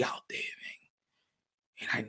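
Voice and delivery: breathy warning whisper. Acted. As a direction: warn them under your breath. Secret confession continues.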